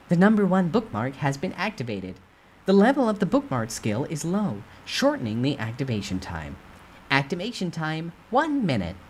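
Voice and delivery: game system announcing the skill; system notification. Acted. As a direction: read it like a game interface.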